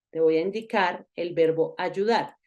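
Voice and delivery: ordinary talk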